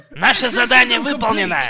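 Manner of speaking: ordinary delivery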